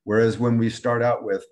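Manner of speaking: ordinary talk, nothing marked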